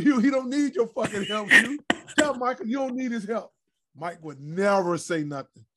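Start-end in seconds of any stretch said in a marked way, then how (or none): 0.0-3.5 high, loud mock yell at Hugh; yelling at the ref. Player shouting at referee Hugh Evans, high and loud. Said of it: "I said".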